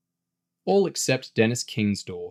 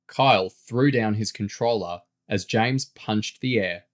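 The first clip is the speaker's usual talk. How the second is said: plain reading voice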